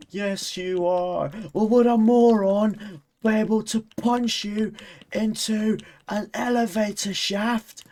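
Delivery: high, loud, drawn-out taunting retort; slow high-pitched shouted comeback, word by word; Portal two argument. Acted. Streamer acting out a game argument, high heated taunt. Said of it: "conversation in Portal two that's like".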